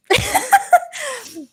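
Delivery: high-pitched real laugh; amused, squeaky-high laughter; high giggle. Real, not acted. Amused while reading chat.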